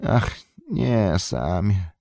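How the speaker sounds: ciszej, smutne westchnienie; łagodnie i smutno, bez gniewu; ze smutkiem. A narrator's reading. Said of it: "rzekł ze smutkiem". (Frodo łagodnieje wobec Sama, smutno i ciszej)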